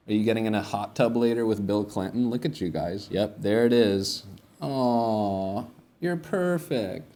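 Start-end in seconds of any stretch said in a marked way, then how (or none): 4.6-7.1 high, drawn-out coo; pet-talk voice. Real, not acted. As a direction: gush slowly to an adorable animal. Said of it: "talk to animals like that".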